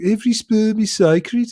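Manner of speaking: high, drawn-out mock Brummie angel; Brummie accent. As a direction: imitate an angel from Birmingham, Brummie vowels. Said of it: "an angel with a Brummie accent going".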